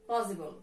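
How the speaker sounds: "Possible" with the stress on POS; POS-si-ble, weight on the opening syllable; stress on first syllable. Shown as a demonstration. Teacher gives three-syllable example "Possible", first syllable stressed. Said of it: "first syllable".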